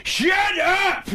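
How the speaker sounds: sudden high-pitched comic yell; Adam Sandler yelling. Acted. Guest showing Sandler's third phase, high yelled impression. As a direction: shout it out in a mock outburst. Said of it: "he yells and you're just like".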